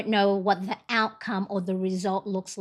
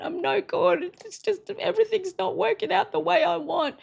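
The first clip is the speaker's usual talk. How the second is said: imitating own crying; 9 semitones higher than usual. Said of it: "on the couch and I'm crying and I'm going".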